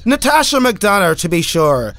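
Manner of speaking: a hint of a stage Irish accent on to be sure. Greeting a listener in a mock Irish accent. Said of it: "Can I say it Irish?".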